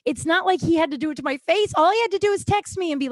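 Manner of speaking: high, loud, fast indignation; exasperated venting. Real, not acted. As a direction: argue it out in real exasperation, pitch climbing. Venting about being stood up.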